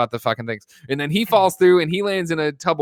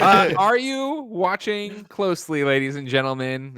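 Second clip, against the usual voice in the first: slow, higher, theatrical announcer voice; dramatic movie narrator; 6 semitones higher, slower than usual. Acted. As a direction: draw them in like a showman. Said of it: "quoting the film's opening line".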